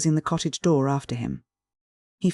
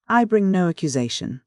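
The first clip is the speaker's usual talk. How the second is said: plain reading voice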